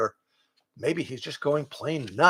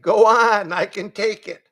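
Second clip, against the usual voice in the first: higher, louder brave goat; martyr goat; 8 semitones higher, 7 dB louder than usual. A narrator's reading. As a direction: take the blame like a goat. Goat bravely offers rump.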